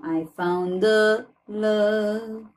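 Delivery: I found the love spoken slowly, words linked; slow pronunciation demo. Shown as a demonstration. Teacher slows down I found the love for students. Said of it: "temos aqui uma consoante".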